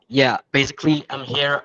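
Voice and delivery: ordinary talk, nothing marked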